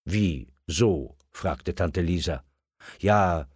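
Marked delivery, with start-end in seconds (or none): none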